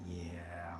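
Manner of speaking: hushed breathy yeah; whispering. Real, not acted. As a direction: whisper it to yourself. Whispered while painting.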